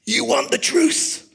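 a light Jack Nicholson growl, the last line slowed and heavy; Jack Nicholson quote. Retold or imitated. Preacher quoting A Few Good Men to the church, a hint of Nicholson. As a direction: snap the question high, then lay down the verdict slowly. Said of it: "which Jack Nicholson says".

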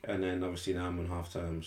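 ordinary talk, nothing marked

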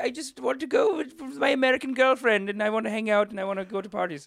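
high, whining put-on voice; whiny playboy prince. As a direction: whine like a spoiled royal. Whiny king who abdicated.

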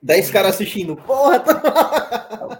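loud laughter through the words; cracking up while talking; laughing. Real, not acted. Laughing at the tiny audience.

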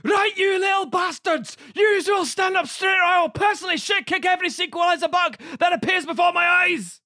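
furious Scottish drill sergeant shout; screaming Scottish accent. Acted. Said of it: "Were you screaming in a Scottish accent at me?".

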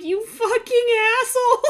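high, loud yell through laughter; outraged mock shout; laughing yell. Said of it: "I was like".

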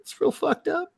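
very high, squeaky tearful voice; mock crying. Viewer upset by the episode, hammed-up tearful squeak. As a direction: squeal it out as if sobbing.

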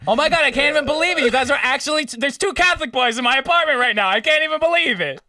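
put-on high, fast gushing girl voice. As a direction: rattle it off, high and thrilled.

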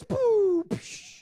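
dismissive lip puff and hiss; scoffing puff. Pretend. Preacher mimics Brother Tryon brushing off baby news with a scoffing puff. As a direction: blow it off like a shocked husband. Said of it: "he'd be like this".

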